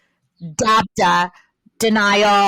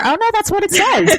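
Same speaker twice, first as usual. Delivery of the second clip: high, louder double-take; mock realization; 10 semitones higher, 3 dB louder than usual. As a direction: act out a surprised self-correction. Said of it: "I'll read it and I'm like".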